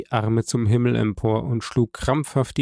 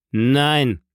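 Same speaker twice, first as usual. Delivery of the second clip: stern refusal; 3 semitones higher than usual. A narrator's reading. Husband refuses the plea.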